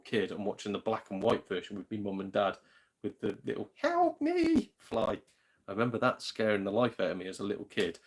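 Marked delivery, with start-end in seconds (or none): 3.8-4.7 very high, tiny imitated fly; squeaky fly voice. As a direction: squeak it like the little fly in the old film. Tiny fly crying for help.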